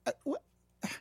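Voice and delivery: sharp high disbelieving really; incredulous. Real, not acted. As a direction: shoot up in disbelief. Incredulous at the critic.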